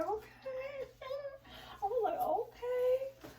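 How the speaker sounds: squeaky high mock-giddy okay; excited okay. Wife hears about a surprise gift, giddy high okay.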